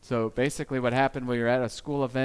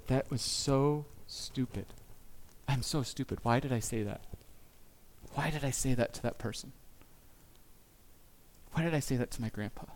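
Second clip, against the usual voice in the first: muttering regret to himself; self-reproach; 6 dB quieter than usual. Retold or imitated. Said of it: "that I go".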